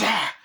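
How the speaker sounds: high, sharp cry; mock attack yell. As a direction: yelp like a lunging doll. Chucky attacking.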